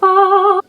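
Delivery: loud high squeal of delight; excited squeal. Real, not acted. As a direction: shriek with delight at the reveal. Opening the palette.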